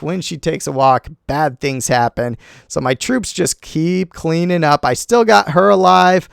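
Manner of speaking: usual speaking voice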